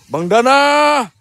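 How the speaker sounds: high, loud, very slow call of the name; drawn-out call. Real, not acted. Vlogger calling out to a friend, long, high and loud. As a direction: stretch the name out calling across the dark.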